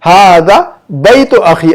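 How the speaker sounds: हादा बैतु अखी repeated slowly, word by word; slow model reading. Shown as a demonstration. Teacher repeating the Arabic after explaining, slowly. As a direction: say the Arabic again, slow and clear. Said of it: "ये इसमें इशारा इसलिए पहले लाएगी इसको".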